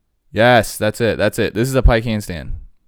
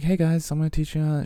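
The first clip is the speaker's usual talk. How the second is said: mock bubbly tutorial host; 8 semitones higher, faster than usual. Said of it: "she's super happy".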